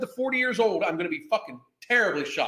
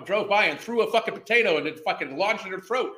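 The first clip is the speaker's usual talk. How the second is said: ordinary talk, nothing marked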